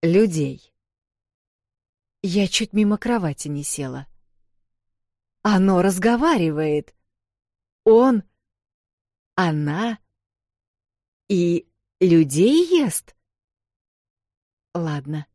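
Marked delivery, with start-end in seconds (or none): 0.0-0.7 borrow a grown man's voice; Valentine's voice. A narrator's reading. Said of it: "произнёс почему-то голосом Валентайна". 7.8-13.2 slow, louder, stress on людей; shocked question. Acted. Shocked at a man-eater.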